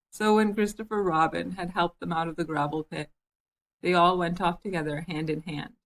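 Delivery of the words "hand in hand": plain reading voice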